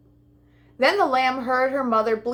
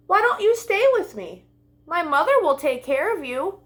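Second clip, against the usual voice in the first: high pleading lamb; 7 semitones higher than usual. Acted.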